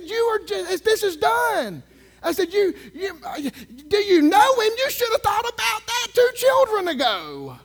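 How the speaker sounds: loud, high exasperated retort; heated retelling. Retold or imitated. Preacher retelling how he lectured the teller, loud and exasperated. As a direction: lay into her, exasperated. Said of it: "And I told her".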